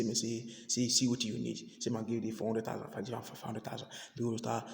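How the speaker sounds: low, soft, secretive offer; hushed secret talk. Acted. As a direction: murmur it so no one else hears. Pastor quietly offers money in secret.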